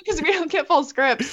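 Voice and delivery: high, laughing explanation. Real, not acted. Explaining to host, still laughing about spoilers. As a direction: talk through the giggle.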